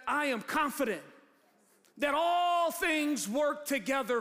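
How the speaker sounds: high, loud, impassioned sermon delivery; full-force preaching. Real, not acted. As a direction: drive each point home like a fired-up preacher.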